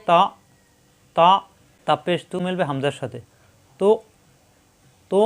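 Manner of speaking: তা and তো closed hard in the throat; hard stop. Shown as a demonstration. Teacher demonstrating a hard stop on তা. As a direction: clip each তা sharply. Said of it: "শক্ত করে উচ্চারণ".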